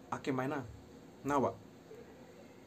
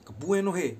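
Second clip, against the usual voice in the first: "Kebuenohe" shouted high and loud, falling; cursing demo; 4 semitones higher, 7 dB louder, slower than usual. Shown as a demonstration. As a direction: spit out "Kebuenohe" like a real curse. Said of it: "Logatnya begini".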